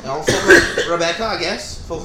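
lightly voiced reluctant groom; [name]. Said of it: "at the altar about to do my vows".

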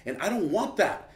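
mildly angry, insistent recollection; retold frustration. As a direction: let the frustration rise, replayed. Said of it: "all that frustration, I'm just like".

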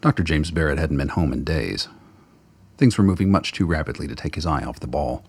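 usual speaking voice

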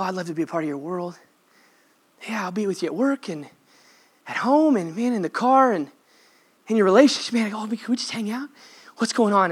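soft, near-whispered invitation; Jesus inviting you to hang out, softly; tender Jesus voice. Retold or imitated. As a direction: hush it like a gentle friend. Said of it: "attitude that Jesus has towards us".

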